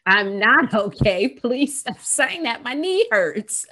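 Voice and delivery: loud, high, drawn-out mock complaint; protesting hurt child. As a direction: play an exasperated kid insisting on being heard.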